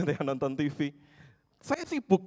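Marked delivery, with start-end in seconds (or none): 1.6-2.3 put-on high, loud old lady shooing him off; imitating a furious grandma; angry old woman. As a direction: chase him away in a fierce grandma voice. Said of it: "semakin keras nadanya".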